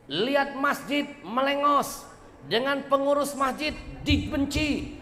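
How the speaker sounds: fiery preaching. Acted. Preacher scolding those who shun the mosque, fiery rant. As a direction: rail against the lazy and the stingy.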